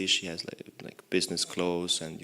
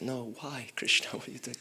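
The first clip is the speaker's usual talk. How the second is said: mock dismay; 5 semitones higher, 5 dB quieter than usual. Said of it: "and I was like".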